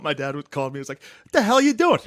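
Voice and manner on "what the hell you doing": much higher, louder laughing dad yell; mock angry dad. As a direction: bark it like a furious father, half laughing.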